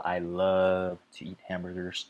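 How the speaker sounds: "love" held long for emphasis; stress on love. Shown as a demonstration. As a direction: stretch out "love", then carry on lightly. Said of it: "You wanna emphasize that love".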